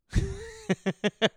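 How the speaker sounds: a sudden high laugh breaks out; high burst of laughter. Real, not acted. Comic laughing at his own absurd story.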